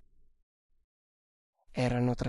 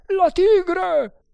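high, loud alarm cry; a guard crying out that the tiger is there; sentinel's shout; 9 dB louder, much slower than usual. A narrator's reading. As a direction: yell it to raise the alarm. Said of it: "sentinelle gridare".

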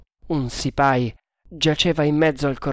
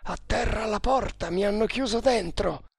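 calling for rescue; 8 semitones higher, 3 dB quieter than usual. A narrator's reading. Nagor, locked inside, tells his companion to break in, calling out. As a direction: plead from behind a door in a high voice.

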